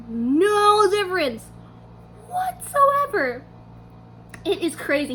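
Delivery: usual speaking voice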